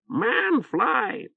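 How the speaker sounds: high croaking bird squawk; hoarse bird voice. Acted. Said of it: "Oreb informed them".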